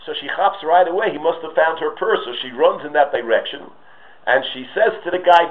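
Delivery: ordinary talk, nothing marked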